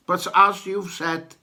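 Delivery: ordinary delivery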